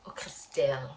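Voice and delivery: soft fond whisper. Real, not acted. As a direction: breathe her name fondly. Fond whispered aside about her.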